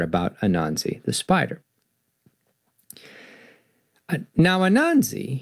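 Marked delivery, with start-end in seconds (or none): 4.1-5.4 lively read-aloud storyteller voice; animated picture-book narration; story narration. A narrator's reading. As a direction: tell it like reading to kids at bedtime. Reads the children's story aloud.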